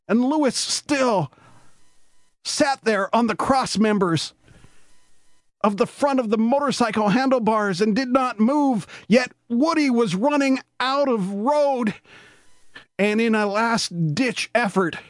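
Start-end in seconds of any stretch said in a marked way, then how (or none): none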